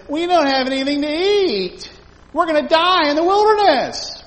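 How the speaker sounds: high, loud whining wail; whiny complaining Israelites. Retold or imitated. Israelites complaining to Moses in the wilderness, high loud whine. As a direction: wail like hungry people sure they'll die. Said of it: "they said".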